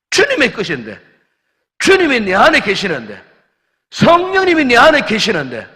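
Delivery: high, loud, insistent self-reminder; fervent inner voice. Acted. Believer reminding himself whom he belongs to, fervent and loud. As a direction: cry out to yourself mid-temptation.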